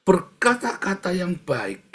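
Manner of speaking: loud, high, slow exhortation; emphatic preaching. Real, not acted. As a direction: drive it home to the congregation. Preacher drives the point home.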